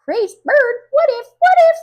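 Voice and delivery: very high, loud squawky parrot talk; high loud parrot mimicry. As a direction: squawk it like a parrot copying words. Bird mimics neighbor.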